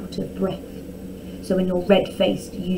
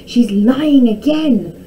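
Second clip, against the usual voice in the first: loud, higher, drawn-out complaint; indignant cry; 4 semitones higher, 8 dB louder, slower than usual. A narrator's reading. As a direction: cry out the accusation slowly. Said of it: "cried Prince Tom".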